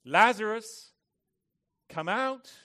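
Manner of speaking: high, commanding shout; loud voice. Acted. Jesus calls the dead man from the tomb, loud command. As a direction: call it out at full volume, words set apart.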